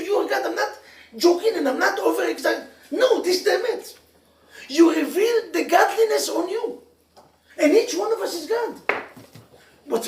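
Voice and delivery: rising fervour, laughing between points, preaching hard; fiery preaching. Acted. Rabbi urging the congregation, fervent laughing preaching. Preaches soul is piece of God.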